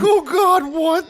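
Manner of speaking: high, loud panicked dad with cracking voice. Retold or imitated. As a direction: crack the voice in alarm. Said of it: "He's like".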